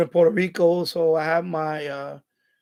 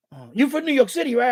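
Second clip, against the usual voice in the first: eager recognition; 6 semitones higher than usual. Retold or imitated. Retold stranger recognizes his accent.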